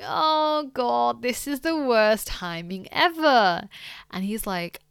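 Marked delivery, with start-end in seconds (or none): 0.0-3.7 drawn-out, exasperated groan of words; mock dismay. Retelling the manager's untimely arrival, hammed-up dismay. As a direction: play up the dread, slowing down. Manager appears as she cries.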